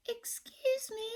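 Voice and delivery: timid high whisper; very small voice. Acted. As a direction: whisper it shyly to the monster. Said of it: "in a very small voice".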